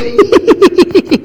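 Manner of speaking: high laughing burst; burst of laughter. Real, not acted. Preacher laughing at his kids hiding, high laugh.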